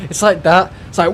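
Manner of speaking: ordinary talk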